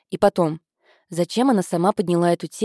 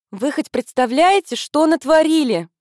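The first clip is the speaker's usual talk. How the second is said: shrill theatrical question, much higher and louder; an octave higher; 9 semitones higher, 5 dB louder than usual. A narrator's reading. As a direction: melodramatic high-pitched outrage. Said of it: "спросила она на октаву выше".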